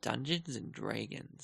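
soft and slow, off-mic; murmured far from the mic; quiet voice loud hiss. Real, not acted. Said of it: "Don't talk quietly when you're closer".

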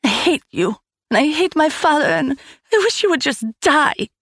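put-on furious girl yelling, pitched well up; child's tantrum. A narrator's reading. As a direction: scream it at the stepmother.

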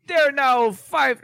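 British attempt sliding into Jamaican; slow, high accidental Jamaican lilt; Jamaican accent. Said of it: "Why are you Jamaican?".

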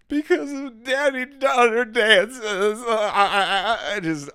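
high, slow laugh-sob; laughing through tears. Real, not acted. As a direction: explain it while cracking up. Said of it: "And I was like".

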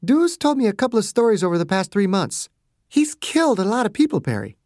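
doctor telling the patient who beat him; serious Margaret. A narrator's reading. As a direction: tell him gravely. Margaret warns Perry.

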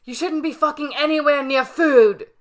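furious chef yelling at a cook; angry yell. Acted. Voices Gordon yelling at cook.

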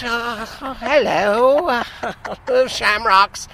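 high, very slow mock Irish accent. Shown as a demonstration. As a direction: ham up a stock Irish accent, laughing at yourself. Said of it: "Here's my Irish".

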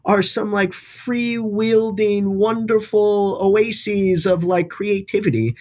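high, slow sarcastic gushing. Acted. Mocking private sector as creative.

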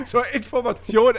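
trying to talk through laughter; breaking up, then pushing on; laughing. Real, not acted. Cracking up mid-sentence.

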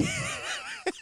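high squealing laugh; real laugh. Real, not acted. Cracking up at his own flirting impression, high laugh.

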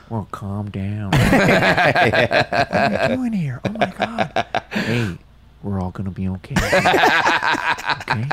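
laid-back actor imitation, drawn-out words; stretched, easygoing impression with amused reassurance; Owen Wilson. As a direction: drawl soothingly, breaking into laughs. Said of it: "it'll just turn into my Owen Wilson".

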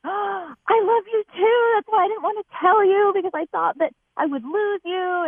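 high-pitched gushing boyfriend imitation; gasp, then pour it out in a high mock voice; imitated boyfriend's confession. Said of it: "and he was like".

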